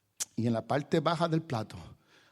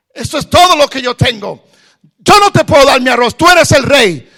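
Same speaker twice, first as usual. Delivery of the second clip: high shouted refusal; beggar refusing; 14 semitones higher, 20 dB louder than usual. Acted. Beggar shouts at the king refusing his rice, high furious yell. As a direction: yell it, clutching your rice. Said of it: "el pobre mendigo decía".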